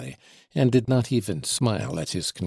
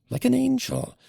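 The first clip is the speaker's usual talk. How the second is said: like an angel, sung out much higher; mother gushing praise, "like an angel" pitched high; gushing echo. Acted. Repeated praise, second take raised.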